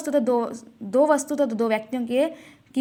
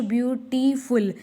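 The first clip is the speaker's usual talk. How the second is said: Beautiful slowly split as "byu-tee, ful"; teacher dividing beautiful into its sounded parts; syllable breakdown; slower than usual. Shown as a demonstration. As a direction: draw out each part of beautiful. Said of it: "दो भागों में बट गया".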